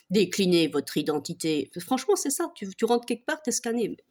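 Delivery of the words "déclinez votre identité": lightly voiced self-important boss, low and slow; slow, lower mock demand for ID; mock official. Group admin demanding identification from newcomers, mock official. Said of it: "ils se prennent genre pour des, des patrons".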